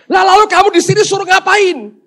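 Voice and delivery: high, loud indignant question; exasperated yelling. Acted. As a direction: lay into the useless helper. Man scolds useless angel.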